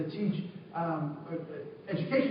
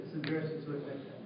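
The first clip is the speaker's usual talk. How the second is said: softer, fast murmured repeat; quick quiet aside; 7 semitones lower, 13 dB quieter, faster than usual. Real, not acted. Teacher repeating the admission to himself, softly and quickly. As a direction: say it under your breath, quickly.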